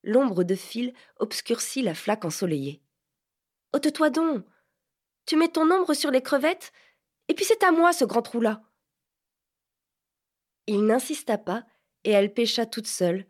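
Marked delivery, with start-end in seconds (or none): 3.7-8.6 put-on girl's voice pitched higher, shooing him off; irritated, possessive girl; sharp young Vanka. Vanka's voice, annoyed.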